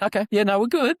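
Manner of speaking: very high-pitched, hasty reassurance; placating. Retold or imitated. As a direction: re-enact backing off in a high voice. Retold placating reply to hostile man.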